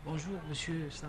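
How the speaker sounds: barely audible greeting; too quiet. Real, not acted. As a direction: say it far too softly. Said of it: "On ne vous entend pas".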